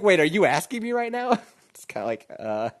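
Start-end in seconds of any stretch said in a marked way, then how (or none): 0.0-1.4 surprised wife. Retold or imitated. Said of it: "She's like".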